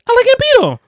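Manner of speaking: very high, loud cartoon girl. [name]'s cartoon confessing, very high and loud. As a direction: squeak it like a cartoon.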